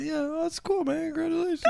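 very high, strangled mock-happy squeak; imitating fake congratulations. Comic imitating friend congratulating him, high strangled squeak. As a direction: squeak it out as if choking back tears. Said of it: "he went like this".

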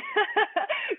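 high burst of laughter; joyful teary laugh. Real, not acted. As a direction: let a happy tearful laugh break out. Joy at God's love.

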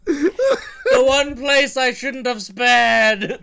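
slow, loud line through laughter; laughing mock lament. Friends mocking the park owner, laughing mock wail. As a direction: wail it while cracking up. Laughing parody of Hammond's regret.

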